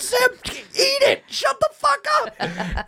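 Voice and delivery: high, slow laughing yell; mock yell back. Friends yelling back at Sam, high slow mock yell. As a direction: shout him off, half laughing.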